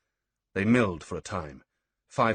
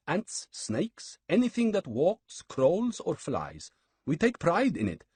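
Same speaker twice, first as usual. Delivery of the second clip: high, mannered priestly voice; sly priest; 9 semitones higher than usual. Acted. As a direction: savour the boast of killing. Mobad Manzik voiced by narrator.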